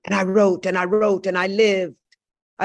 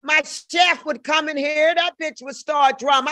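usual speaking voice

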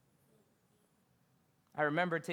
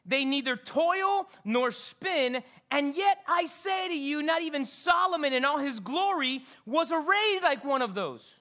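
slow, higher, louder sermon reading; preaching scripture; 7 semitones higher, 6 dB louder than usual. Acted. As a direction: declaim the verse to the congregation. Preaching scripture at full force.

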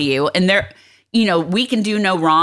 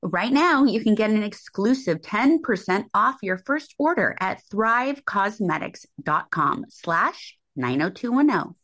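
softer sponsor read; 3 semitones higher, 7 dB quieter than usual. Real, not acted. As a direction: read the makeup copy gently to listeners. Reads a sponsor ad.

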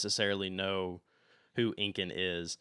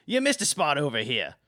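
high and loud, barking orders across the shop; bossy call; 7 semitones higher, 9 dB louder than usual. Acted. Valette loudly orders Snips to clean up. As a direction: call him over like a servant. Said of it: "she says".

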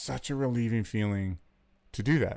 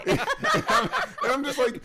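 much higher squeaky laughing words; breaking into laughter mid-sentence; 3 dB louder than usual. Real, not acted. Laughing while telling.

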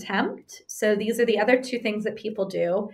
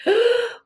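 sharp, high theatrical gasp; a hammed-up gasp of dismay; mock gasp; 13 semitones higher than usual. Wife walking in on the messy house, mock gasp.

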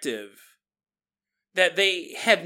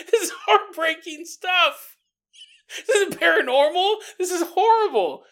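laughing lament; 11 semitones higher, 5 dB louder than usual. Real, not acted. Host overcome laughing at topic.

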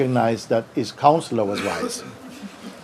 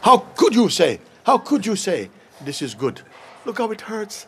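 higher indignant outburst, half laughing; the king rounds on his counselor; angry king; 9 semitones higher than usual. Retold or imitated. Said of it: "The king flew into a rage".

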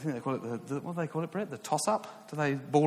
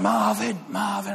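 high, raised-voice crowd chant; crowd cheer; 9 semitones higher, 4 dB louder than usual. Retold or imitated. Students calling to their winning player, cheering. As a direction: call it out like cheering fans.

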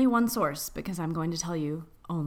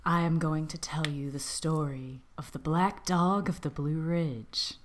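creepy story voice; 3 semitones lower, 3 dB quieter than usual. Acted. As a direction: whisper it like a ghost story. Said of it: "creepy story voice".

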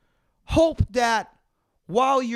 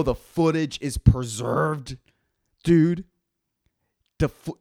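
ordinary talk

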